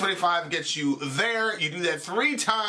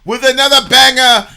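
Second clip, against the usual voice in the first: loud, high show-host proclamation; hammed-up announcer; 10 semitones higher, 14 dB louder than usual. Host sends listeners to a commercial break, hammed-up announcer. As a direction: belt out the outro like a grand announcer. Hams up the show's announcer outro.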